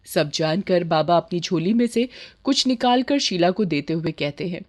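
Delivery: usual speaking voice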